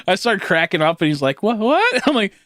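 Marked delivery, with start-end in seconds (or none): 1.4-2.0 confused what. Retold or imitated. Patient asks why he laughs.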